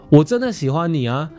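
normal talking voice

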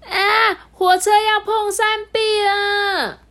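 high, loud, slow panicked shout; alarmed cry. Acted. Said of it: "传来了一阵惊呼".

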